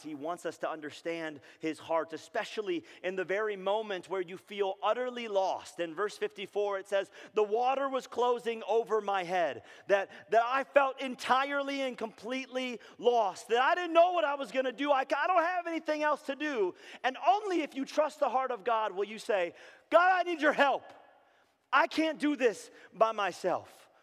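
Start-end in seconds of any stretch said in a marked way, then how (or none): 2.1-19.5 rising higher and louder to full force; building impassioned preaching. Acted. Preacher describing being utterly lost, building full-force preaching. 19.9-23.9 high, loud plea, slowing at the end; desperate cry out. Acted. Believer who feels lost begging God, desperate loud cry.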